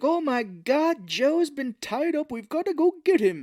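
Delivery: high, slow panicked Santa; alarmed Santa. Retold or imitated. Santa reading the letter, hammed-up high alarm. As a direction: gasp it out in mock alarm.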